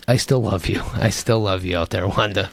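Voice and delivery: mock tender. Host reassures a hater on air, mock tender. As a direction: lovingly reassure the hater, tongue in cheek.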